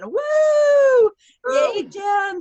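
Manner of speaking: high, loud cheering whoop; excited whoop. Real, not acted. Host cheering the top seller on the call, high excited whoop. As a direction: cheer her on like she's in the room.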